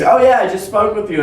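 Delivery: high-pitched chirpy phone clerk; guest services guy. Retold or imitated. Clerk recognizes the repeat caller, high-voiced. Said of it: "And the guy goes".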